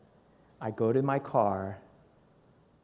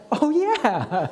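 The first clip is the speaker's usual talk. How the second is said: a high, loud "oh, yeah" as it dawns on him; sudden realization; 9 dB louder than usual. Retold or imitated. Husband conceding to his wife, high dawning oh yeah. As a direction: admit she's right in a flash. Wife's reminder about Banff lands.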